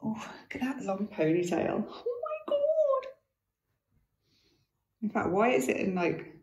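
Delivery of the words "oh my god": much higher and drawn out; delighted gasp. Real, not acted. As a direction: squeal of delight. Sees the dog's long ponytail.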